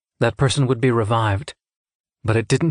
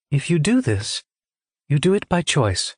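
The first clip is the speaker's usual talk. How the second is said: higher-pitched, measured character voice; composed examiner; 5 semitones higher than usual. A narrator's reading. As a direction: lay down the terms evenly, pitched up.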